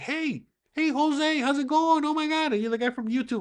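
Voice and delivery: high-pitched imitation of a starstruck fan; excited fan greeting. Fan spots YouTuber on the street, mock excited.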